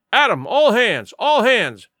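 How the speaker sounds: a narrator's rousing pirate roar; thunderous roar. A narrator's reading. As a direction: roar the order out to the men. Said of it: "he roared in a voice of thunder".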